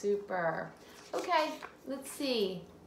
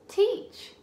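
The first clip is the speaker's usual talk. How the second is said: "teach" said loud and much higher; triumphant blend; 11 semitones higher, 7 dB louder than usual. Shown as a demonstration. Teacher proudly blending "teach" for the child. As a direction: land the whole word with delight. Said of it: "This one's tricky".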